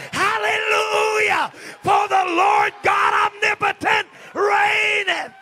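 high, slow, drawn-out proclamation; triumphant preacher's hallelujah shout; shouted praise. Shown as a demonstration. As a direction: shout it out long and high to the heavens. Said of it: "lift up your voice tonight and shout it".

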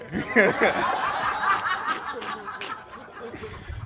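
real, breathy drawn-out laughter; long laugh. Real, not acted. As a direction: break into a long helpless laugh. Breaks into laughter.

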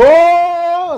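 very high, loud imitated whoop; excited shout. Friends turn around and spot [name], excited shout. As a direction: erupt like starstruck friends.

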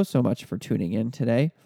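usual speaking voice

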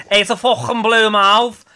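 loud, high Scouse exclamation; hammed-up Liverpool accent. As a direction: belt it out in exaggerated Scouse. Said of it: "that was a terrible Scouse accent".

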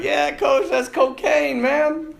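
high, mocking voice of a cocky freshman; cocky player. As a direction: toss it off like a smug rookie. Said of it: "some of the guys are like".

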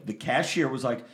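ordinary talk, nothing marked

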